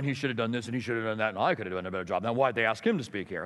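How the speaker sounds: low, fast grumbling voice of critics; critical pastors grumbling. Retold or imitated. Pastors judging the speaker, griping under their breath. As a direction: mutter it like a sour critic in the pews. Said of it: "These guys are gonna be sitting there going".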